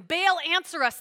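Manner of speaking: understated narrated shout; shouted plea. A narrator's reading. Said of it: "they shouted".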